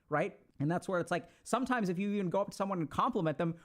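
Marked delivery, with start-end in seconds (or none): none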